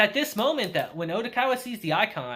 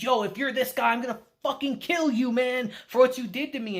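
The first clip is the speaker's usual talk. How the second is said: raised, heated threat with a strained edge; threatening; 6 semitones higher than usual. Retold or imitated. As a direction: spit the threat out at him, higher and tense. Tanaka threatening Dobu.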